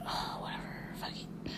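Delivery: breathy resigned whisper under the breath. Retold or imitated. Patient giving up on the nurse, in a whisper. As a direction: mutter it to yourself, giving up. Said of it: "And I'm just like".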